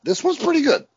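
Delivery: hammed-up insistence; mock emphatic verdict. Shown as a demonstration. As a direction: sell it like a fan defending the movie. Restating the verdict two ways.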